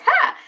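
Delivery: high, loud single "ha"; sudden high exclamation of triumph; mock triumphant "ha". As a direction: burst out one big imitated laugh. Imitating a big triumphant laugh.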